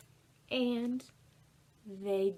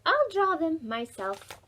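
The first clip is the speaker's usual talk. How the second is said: higher, louder resolve; determined girl; 6 semitones higher, 6 dB louder than usual. A narrator's reading. Ruby to the boys, a higher, louder determined reply. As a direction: say it like she'll show them.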